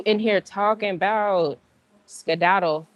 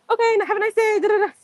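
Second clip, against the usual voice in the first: bright high fake-friendly voice, much higher; high polite brush-off; 11 semitones higher, faster than usual. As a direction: chirp it up high to get away politely.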